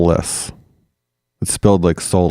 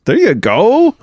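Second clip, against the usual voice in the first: squeaky high encouragement; high cheer; 13 semitones higher, 4 dB louder, slower than usual. Real, not acted. Cheering the cohost's accent, high and delighted. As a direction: cheer your friend on.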